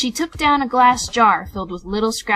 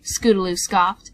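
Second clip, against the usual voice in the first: ordinary delivery, nothing marked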